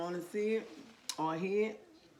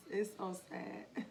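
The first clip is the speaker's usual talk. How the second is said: ordinary talk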